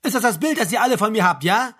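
high, loud angry rant; outraged officer raising his voice. A narrator's reading. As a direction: build indignantly to a bellow. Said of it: "Der Major wurde wieder lauter".

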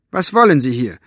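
sharp, loud rebuke; snapping at him. A narrator's reading. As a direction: bite his head off. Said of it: "fuhr Elinor ihn an".

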